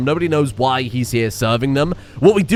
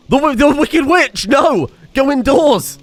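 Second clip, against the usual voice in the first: much higher, louder panicked yell; alarmed shout; 10 semitones higher, 4 dB louder, slower than usual. Real, not acted. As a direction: shout her indoors before the rain gets her. Panicked shouting at the witch in the rain.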